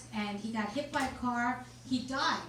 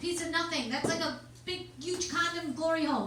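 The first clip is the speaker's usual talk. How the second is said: usual speaking voice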